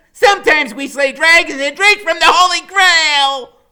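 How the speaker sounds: high, loud heroic declaration; booming heroic speech; grand proclamation. A narrator's reading. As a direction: announce their legend to the class. Freak proclaims from Max's shoulders.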